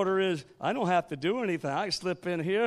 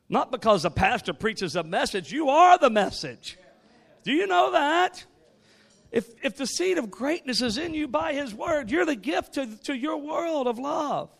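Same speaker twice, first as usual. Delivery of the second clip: full-force preaching; 8 semitones higher, 4 dB louder than usual. Acted. Pastor exhorting his church, in full preaching force. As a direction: drive the point home from the pulpit.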